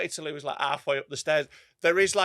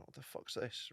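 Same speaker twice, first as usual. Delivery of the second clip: voiceless hushed whisper; audience whisper; 5 semitones lower, 22 dB quieter than usual. Retold or imitated. Comic voicing puzzled audience, hushed whisper. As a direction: mutter it like a puzzled crowd. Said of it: "Everyone's watching, like".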